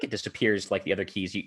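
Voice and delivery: ordinary delivery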